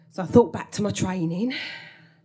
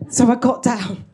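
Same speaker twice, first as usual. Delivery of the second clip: louder, firm "So I got down"; punchy repeat; 3 semitones higher, 6 dB louder than usual. Shown as a demonstration. Telling the audience she hid, punchy and loud.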